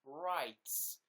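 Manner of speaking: slow, high hammed-up American declaration; American accent. Pretend American insisting on his rights, slow and high. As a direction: drag it out like a proud mock American. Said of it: "the best way to do an impression of America".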